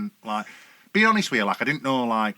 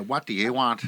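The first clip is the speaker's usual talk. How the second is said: lightly done Belfast lilt on a question; Belfast accent; slower than usual. Retold or imitated. Mimicking a Belfast girl for the lads, light Belfast accent. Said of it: "Belfasty kind of accent on a girl".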